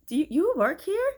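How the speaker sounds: high-pitched, stumbling disbelief; surprised question. Acted. Shopper realizing the helper may not be staff, surprised. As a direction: imitate a taken-aback shopper, voice jumping up.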